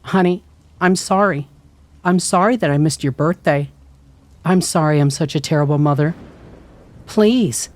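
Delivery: high, tearful apology; pleading, voice breaking. A narrator's reading. Mom begging forgiveness through the door, tearful. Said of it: "Her voice broke and she started to cry".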